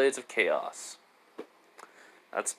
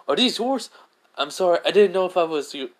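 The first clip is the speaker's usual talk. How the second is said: flustered Naruto; 6 semitones higher, 5 dB louder, faster than usual. Acted. Naruto flustered, apologizing to Kratos.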